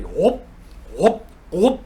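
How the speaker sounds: three short rising whoops; imitated gulps. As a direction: mimic downing each vat in one swallow. Imitates Odin gulping three vats.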